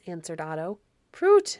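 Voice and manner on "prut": put-on high, loud girlish exclamation; scornful little girl. Little girl scoffing at Otto, high and loud. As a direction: pop it out high and loud like a child. Voices the Baron's little daughter.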